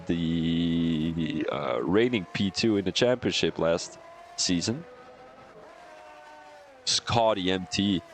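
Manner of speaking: usual speaking voice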